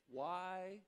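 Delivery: soft, slow, tender voicing of Jesus; Jesus calmly asking his frightened disciples; gentle question. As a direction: ask it quietly, with compassion. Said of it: "and he says".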